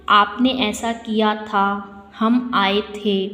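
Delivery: very slow, pausing between word groups; slow, halting speech. Shown as a demonstration. Teacher showing pupils how to be understood, slow and halting. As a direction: spell it out word by word, unhurried. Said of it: "रुक रुक कर बोलते हैं ना कई बार".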